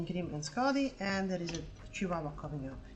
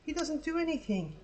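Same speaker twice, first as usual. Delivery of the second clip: much higher, slow, whiny excuse; mock whiny owner; 12 semitones higher, slower than usual. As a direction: whine like a careless dog owner. Dog owner excuses himself.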